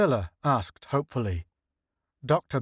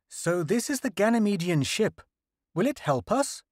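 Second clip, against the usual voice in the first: lightly raised voice of an eager official; a hint of a higher, hopeful leader; Senate leader voice; 8 semitones higher than usual. Senate leader asks scientists about the alien ship, lightly raised voice.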